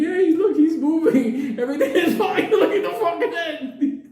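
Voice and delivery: high, laughing false cheer; hammed-up orderly covering up, laughing; mock reassurance. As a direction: insist brightly through giggles. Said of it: "Benny took the dog and goes".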